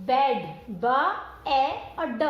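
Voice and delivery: बेड split into ब, ए और ड, firm and a bit loud; sounding out. Shown as a demonstration. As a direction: break the word into its sounds. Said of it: "Similarly बेड". (शब्द को ध्वनियों में तोड़कर)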